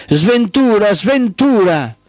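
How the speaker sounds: high, drawn-out dramatic cry; theatrical yell. A narrator's reading. Daughter of night yells the name Sventura, theatrical cry. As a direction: call it out high, stretching the last word. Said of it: "urlò".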